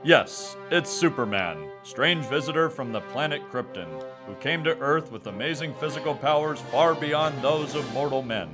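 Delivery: usual speaking voice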